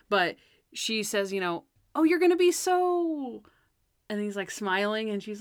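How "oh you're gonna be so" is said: high, drawn-out mock gushing; stretched, high-pitched enthusiasm, put on; acted gushing. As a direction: imitate someone gushing encouragement. Acting out Natalie gushing to Monk.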